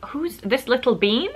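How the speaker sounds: normal talking voice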